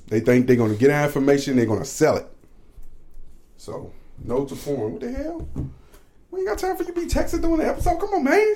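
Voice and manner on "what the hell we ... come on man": high, mock-outraged scolding; hammed-up high-pitched scold. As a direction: squeal the complaint way up high. Scolding partner for texting.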